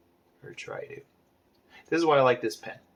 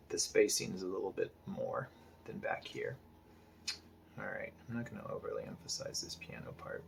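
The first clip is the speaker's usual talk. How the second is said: whispering, much softer, thinking aloud; whispered aside; 3 semitones lower, 8 dB quieter than usual. Real, not acted. Artist talking himself through the piano, whispering. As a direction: murmur it under your breath. Muttering to himself while drawing.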